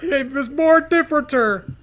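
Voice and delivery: high, loud goofy outcry; mock shock. Friend topping the joke with a goofy outcry. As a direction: top the joke with silly alarm.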